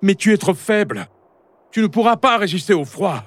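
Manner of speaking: put-on high-pitched, pressing young voice; insist, pleading your case to a stubborn father; urgent high-voiced son; insistent. A narrator's reading. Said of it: "insista Rumio".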